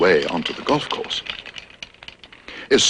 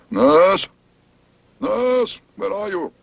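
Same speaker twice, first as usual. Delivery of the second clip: calling out; 10 semitones higher, much slower than usual. Acted. Dentist calling for his nurse.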